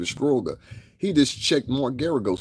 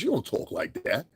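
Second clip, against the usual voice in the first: ordinary delivery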